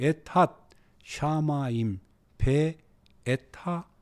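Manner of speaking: slow, word-by-word recitation; slow demonstration reading. Shown as a demonstration. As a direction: pause between each foreign word. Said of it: "이렇게".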